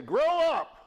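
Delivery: high, loud stern command; God telling us firmly to grow up. Retold or imitated. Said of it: "God doesn't just say".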